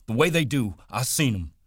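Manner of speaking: proud, bursting with it; excited Lenny. Acted.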